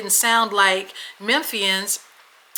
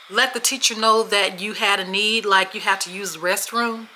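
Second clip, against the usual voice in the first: ordinary delivery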